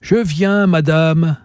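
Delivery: appel lancé bien plus haut; héler vers la salle; crié à la cantonade. A narrator's reading. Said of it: "elle cria à la cantonade". (Emma crie à la cantonade vers la cliente)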